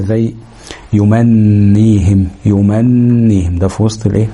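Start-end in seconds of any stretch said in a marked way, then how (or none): 0.9-2.3 يمنيهم with a long hum on the middle ن; held ghunna. Shown as a demonstration. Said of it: "هنتك على النون شوية". 2.5-3.6 يمنيهم with the doubled ن hummed long; held ghunna. Shown as a demonstration. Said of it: "هنتك على النون شوية".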